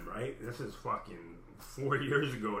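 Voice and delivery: ordinary delivery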